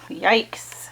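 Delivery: breathy whispered fright; whispered yikes. Acted. Reader whispering fear at the monster picture to the children. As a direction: whisper it like you're scared.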